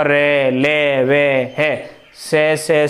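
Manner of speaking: रे, ले dragged very slowly, then से; consonant sounds demo. Shown as a demonstration. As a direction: stretch रे and ले to feel the buzz. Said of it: "ये कंपन होगा". (रे, ले, वे को बहुत धीमे खींचकर बोलना)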